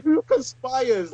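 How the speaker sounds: slow, high disbelieving question; incredulous. Retold or imitated. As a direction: ask it as if it's absurd. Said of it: "I'm like".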